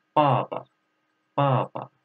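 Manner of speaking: 爸爸 said with a falling fourth tone; tone drill. Shown as a demonstration. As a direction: stress the fall on 爸爸. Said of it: "Fourth tone".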